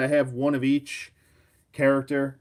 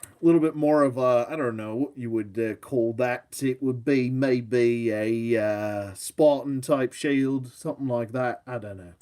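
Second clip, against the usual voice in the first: put-on poor English accent. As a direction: attempt an English accent, lingering on the words. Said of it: "my poor attempt at a English accent".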